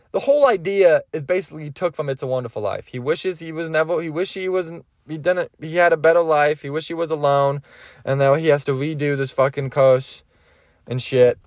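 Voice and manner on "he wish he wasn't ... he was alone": ordinary talk, nothing marked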